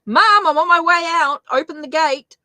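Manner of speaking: mock urgent call from the car; daughter calling out. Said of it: "as she does, to say".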